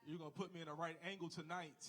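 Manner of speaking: usual speaking voice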